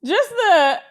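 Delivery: high, loud laughter, slowing into a laugh-cry; can barely get the words out for laughing; embarrassed laughter. Real, not acted. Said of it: "I'm embarrassed".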